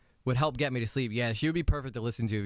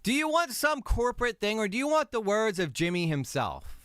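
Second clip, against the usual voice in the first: heated; 9 semitones higher than usual. Real, not acted. Heated rant about corporate language.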